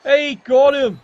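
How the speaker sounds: loud, high burst of delight; real excited commentator yelp; excited cheer. Real, not acted. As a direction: cheer the hit as it lands.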